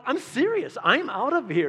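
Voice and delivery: high, insistent protest; indignant high protest; indignant young self. Retold or imitated. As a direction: squeak out a stubborn declaration.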